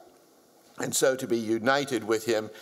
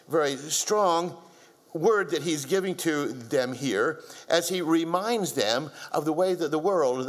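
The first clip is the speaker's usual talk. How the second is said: ordinary talk, nothing marked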